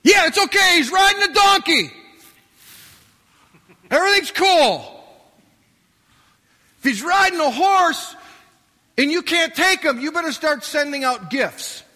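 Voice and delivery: high, loud call from the city wall; watchman shouting. Acted. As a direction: bellow the all-clear down from the wall. Watchmen calling from the walls.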